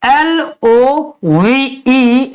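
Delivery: letters spelled very slowly; slow spelling. Shown as a demonstration. Teacher spelling done letter by letter for pupils. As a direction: drag out each letter of the word. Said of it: "इसको तो सभी लोग जानते ही हैं".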